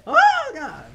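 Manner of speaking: very high, loud scream; panicked cry. Pretend. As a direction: shriek it in game panic. Reacting to fire in game.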